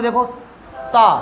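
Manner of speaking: ता slowly, tongue against the teeth; dental ta. Shown as a demonstration. Teacher demonstrating the dental ता to students. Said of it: "बोल लो दांत से".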